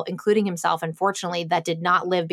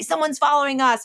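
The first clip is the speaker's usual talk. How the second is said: higher-pitched pretend alert; lightly urgent, raised voice; mock alarm; 7 semitones higher than usual. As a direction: play at spotting a tail. Acting out pretend chase alert.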